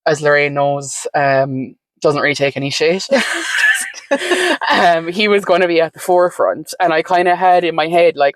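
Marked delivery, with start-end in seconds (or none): 3.1-4.6 a short high burst of laughter; real amused laugh. Real, not acted. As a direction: laugh it off at the partner's toughness. Laughs about partner's toughness.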